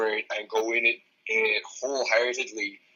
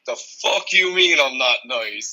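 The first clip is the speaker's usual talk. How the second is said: mock indignant; 4 semitones higher, 3 dB louder than usual. Retorting to client, playing it up.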